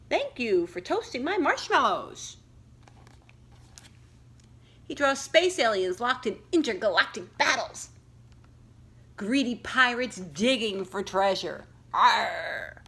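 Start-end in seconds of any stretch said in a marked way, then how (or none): 0.1-2.4 high, cheerful little voice; cute dragon friend. Acted. 11.9-12.8 loud growling pirate arr. Acted. Pirate drawing voiced.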